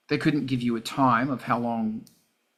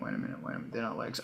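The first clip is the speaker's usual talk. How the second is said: breathy whisper, much lower and softer; whispered to himself; 4 semitones lower, 14 dB quieter, much faster than usual. Real, not acted. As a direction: whisper it as if catching a mistake. Catches himself mid-sentence.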